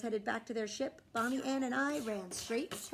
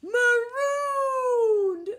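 higher, slow drawn-out moan; moaning crew; 12 semitones higher, 11 dB louder than usual. A narrator's reading. Pirate crew moaning about being marooned. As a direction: moan it in despair. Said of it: "moaned the others".

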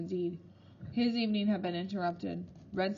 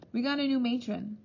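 ordinary delivery, nothing marked

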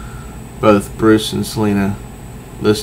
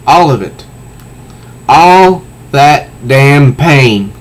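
impassioned reading; 3 semitones higher, 3 dB louder, much slower than usual. Acted. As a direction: swell into the pain of it. Selina's climactic speech, in tears.

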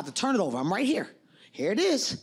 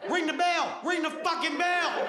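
higher, pleading yell; desperate shout; 7 semitones higher, slower than usual. Retold or imitated. Blinded boxer yelling at the ref, desperate shout. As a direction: beg them to end the round. Begging while blinded in the ring.

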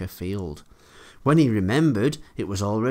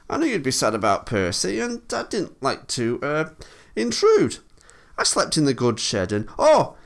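halting, higher-pitched engine voice; awkward storybook character covering up; sheepish excuses; 6 semitones higher, 4 dB louder than usual. A narrator's reading. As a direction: fumble for an excuse, breaking off with a high oh. Thomas making awkward excuses.

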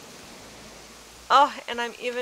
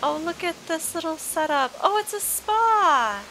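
gushing delight; 9 semitones higher than usual. Real, not acted. Gushing over a cute spa.

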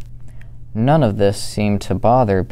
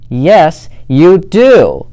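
loud, much higher cry; cried out; 8 semitones higher, 9 dB louder, slower than usual. Acted. Fluffy Bear crying out at Smelly Bear, loud and high. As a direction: raise the voice to win the fight. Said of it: "cried Big Fluffy Bear".

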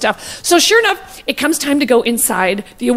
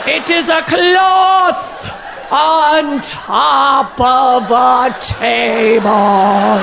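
mock poet, very slow ponderous cadence; hammed-up, drawn-out grand oration; Maya Angelou; much slower than usual. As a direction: stretch every word out hugely, as solemn recitation. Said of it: "the cadence drives me insane".

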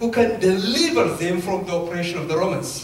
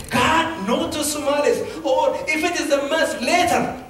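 retold protest; 6 semitones higher than usual. Re-enacts his objection to God.

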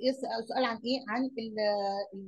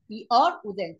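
emphatic answer; 8 dB louder than usual. Shown as a demonstration. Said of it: "teacher stressing the answer".

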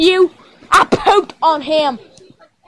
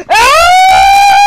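high, loud sustained scream; long scream; 13 semitones higher, 4 dB louder than usual. Pretend. Toy victim being tormented, long high scream. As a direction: draw out a high, piercing scream. Torture scene, victim cries out.

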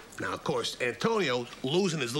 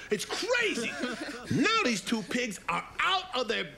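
usual speaking voice

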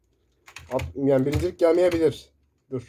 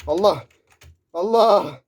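shouting; 2 semitones higher, 4 dB louder, slower than usual. Real, not acted. Streamer shouting in shock at a sudden enemy, loud yell. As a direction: blurt it out loud at the jump scare. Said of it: "Biraz fazla bağırıyorum".

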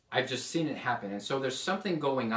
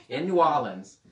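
a light New Orleans local accent, a bit louder; 3 semitones higher, 7 dB louder than usual. Shown as a demonstration. Preacher showing off a New Orleans accent to the class. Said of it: "I have to make sure I do an accent from the place".

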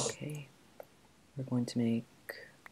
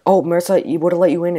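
loud, higher call to a friend; 6 semitones higher, 14 dB louder than usual. Real, not acted. Player calling out to Marissa on the voice chat. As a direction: call across to her.

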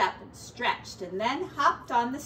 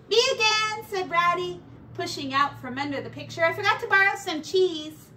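higher, louder breezy rat neighbour; a lightly raised voice for a jolly rat; cheeky pack rat, pleased with himself; 7 semitones higher, 7 dB louder than usual. Acted. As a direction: sell him as a cheerful freeloader. Ratty's voice held in story.

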